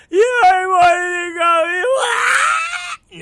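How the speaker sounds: put-on high, loud, drawn-out bawling kid; screaming child. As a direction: imitate a wailing tantrum at full volume. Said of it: "everything that Major is screaming about".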